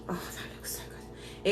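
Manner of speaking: slow, soft whispered awe. Real, not acted. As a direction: breathe it out in an awed whisper. Admiring the finished pita.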